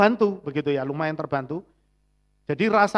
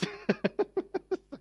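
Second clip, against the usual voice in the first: a high, breathy self-mocking giggle; high embarrassed laugh; 12 semitones higher, 7 dB quieter than usual. Real, not acted. Pastor laughing at his own crying to the congregation, high embarrassed giggle. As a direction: laugh at yourself, a little sheepish.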